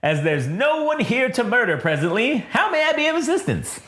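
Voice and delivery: mannered, polite protocol droid; 1930s announcer droid. Acted. Droid offering assistance to masters, in mannered announcer voice. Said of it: "like the nineteen thirties announcer guy".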